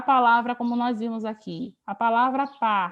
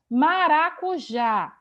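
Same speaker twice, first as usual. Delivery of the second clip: "Maracujá" stretched out, louder; slow, emphatic word; 4 semitones higher, 5 dB louder, much slower than usual. Shown as a demonstration. Teacher presenting the next example word, slow and emphatic. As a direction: draw out "Maracujá" slowly and firmly.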